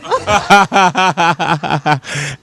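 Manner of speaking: long hearty laugh. Real, not acted. Host laughing along with smiling diners, hearty laugh. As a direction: keep a hearty laugh rolling.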